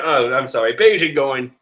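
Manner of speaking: normal talking voice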